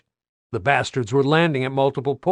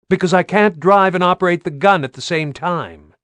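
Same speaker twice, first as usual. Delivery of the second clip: raised voice; 6 semitones higher, 6 dB louder than usual. Acted. Radio over vehicle noise.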